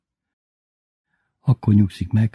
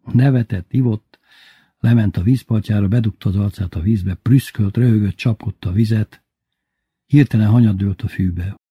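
ordinary delivery

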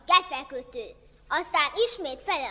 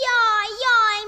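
mock wailing; 5 semitones higher, 8 dB louder than usual. Zsuzsi fakes distress.

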